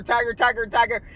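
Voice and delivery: ordinary talk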